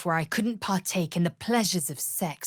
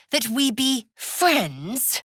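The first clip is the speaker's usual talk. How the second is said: slow, high, sneering question; mock the very idea; slow disdainful sneer; scornful mockery; 7 semitones higher, 3 dB louder, much slower than usual.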